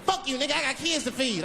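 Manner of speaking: put-on high, indignant baby; baby's voice. Baby telling off the man in the car, high indignant voice. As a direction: snap back high as an offended baby. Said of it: "He said".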